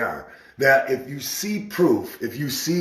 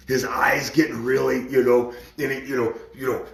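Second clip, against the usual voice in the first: ordinary talk, nothing marked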